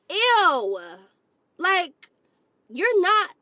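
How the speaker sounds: loud, high disgusted ew. Real, not acted. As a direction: recoil in disgust. Disgusted reaction.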